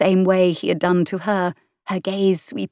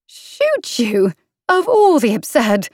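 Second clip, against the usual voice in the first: rising, louder indignant outburst; outraged disbelief; 5 semitones higher, 4 dB louder, slower than usual. A narrator's reading. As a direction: sputter in offended shock. Heroine outraged, cut off.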